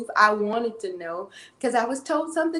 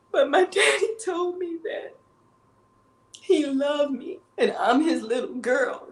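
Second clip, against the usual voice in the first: crying; 4 semitones higher than usual. Real, not acted. Telling of her late daddy's love.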